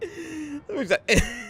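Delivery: a high, breathless cackle; high wheezing laugh. Real, not acted. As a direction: crack up high. Laughs at the clip.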